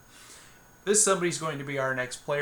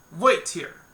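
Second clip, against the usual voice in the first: curt bartender; 3 semitones higher, 7 dB louder than usual. Acted. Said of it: "excuse any really poor accents".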